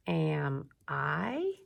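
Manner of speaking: "Am I" read slowly as a question, "I" gliding up; title read with an upward lilt on "I"; rising question. Shown as a demonstration. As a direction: lift the voice at the end of "I". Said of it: "my voice goes up a little bit".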